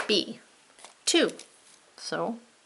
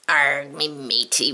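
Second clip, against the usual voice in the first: pirate voice; 4 semitones lower than usual. Acted. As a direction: lower pirate growl of a greeting. Pirate answers the teacher.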